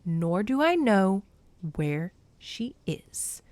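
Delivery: slow, measured denial, sinking at the end; soft, steady. A narrator's reading. Zeus calmly tells Demeter he doesn't know, soft. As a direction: let it out slowly and gently. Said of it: "his voice soft".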